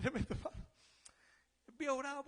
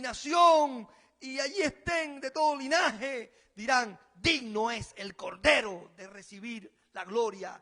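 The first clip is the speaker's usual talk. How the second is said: full-force preaching; 7 dB louder than usual. Acted.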